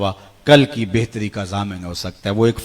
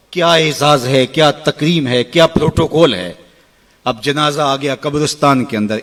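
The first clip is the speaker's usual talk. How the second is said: ordinary delivery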